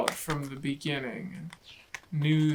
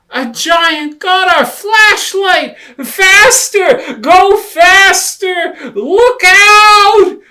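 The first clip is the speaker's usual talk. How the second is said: very high, very loud frantic yells; panicked screaming; 21 dB louder, slower than usual. Acted. Rabbit urges Lion to go faster, high panicked screaming.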